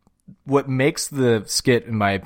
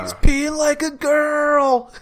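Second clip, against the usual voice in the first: high, loud, drawn-out holler; mock squealed jeer; 5 dB louder, much slower than usual. Quoting the skit to a co-host, high slow yell.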